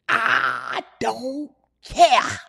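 put-on slow, crying old voice; old person voice. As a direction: drag out a whiny old man. Old person caricature, drawn out.

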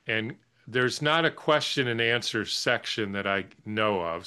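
usual speaking voice

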